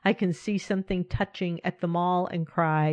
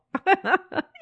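sudden high burst of giggles; a quick real giggle; high giggling laugh; 12 semitones higher, 4 dB louder than usual. Real, not acted. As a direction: laugh at yourself, light and high. Laughs at herself.

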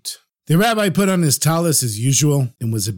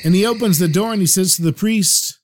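usual speaking voice

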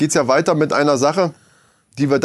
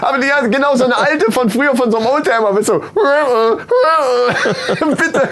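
laughing; 10 semitones higher than usual. Real, not acted. Laughing while explaining the gag.

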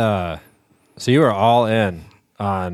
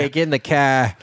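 mock Boston accent, pitched higher; 4 semitones higher than usual. Host teasing Boston guest, mock Boston accent. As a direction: tease the guest with a quick Boston impression. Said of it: "As you say, "Car"?".

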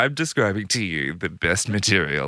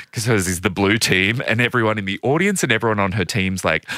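ordinary talk, nothing marked

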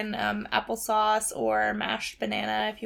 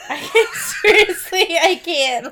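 laughing; 7 semitones higher, 9 dB louder, slower than usual. Real, not acted. Laughing fit while talking.

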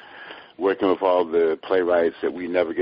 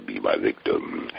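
low, slow horror villain line; Candyman; 10 semitones lower, 3 dB quieter, slower than usual. Acted. Actor shows hosts how his horror character talks, low and slow. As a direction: intone it slowly, deep down in the voice. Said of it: "Candyman talks in the Iambic perimeter".

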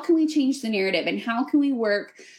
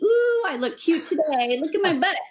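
mock self-admiring; 7 semitones higher than usual. Imagined cheerful self-praise, laughing.

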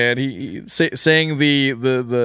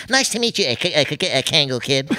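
Porky Pig stutter; 2 semitones higher, faster than usual. Retold or imitated. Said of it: "how he got the job of Porky".

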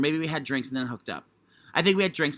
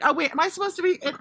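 high confused voice; 13 semitones higher than usual. Retold or imitated. Retelling his confused breaking character.

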